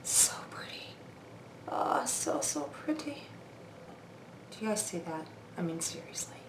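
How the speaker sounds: very soft, breath-only murmur of wonder; awed whisper. Real, not acted. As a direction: whisper it as if lost in admiration. Awed by highlighter while applying it.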